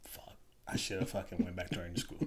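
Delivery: hushed, breathy whispered grumble; whispered curse. Retold or imitated. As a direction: mutter it under your breath like a secret regret. Buddy's regret retold as aside.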